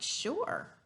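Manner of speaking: an eager yes to a classmate; exclamation point. Shown as a demonstration. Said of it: "he answers with an exclamation point".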